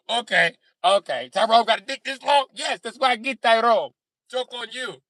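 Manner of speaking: high, heated comeback in a pretend spat; acted lovers' quarrel. Partners trade insults in a staged spat, indignant. Acting out racial argument between couple.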